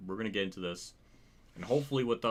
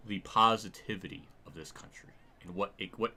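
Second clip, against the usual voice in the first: ordinary talk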